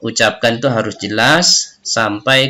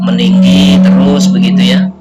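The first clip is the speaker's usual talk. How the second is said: louder, climbing like a mock angry outburst; mock angry rising voice; 4 semitones higher, 9 dB louder than usual. Shown as a demonstration. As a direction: show how anger pushes the tone up. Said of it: "misalnya kita marah". (contoh nada marah yang meninggi dan lebih keras)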